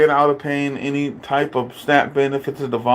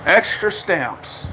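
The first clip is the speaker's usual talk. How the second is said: drawn-out emphasis; 2 semitones higher, much slower than usual. Real, not acted. Emphatic repeat of the point.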